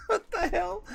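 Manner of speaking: high, laughing "what the hell"; laughing disbelief. Real, not acted. Lost floppy disk, laughing.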